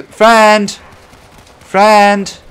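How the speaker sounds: loud, high call to a teammate; yelling. Pretend. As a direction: shout across to get their attention. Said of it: "I don't mind him yelling".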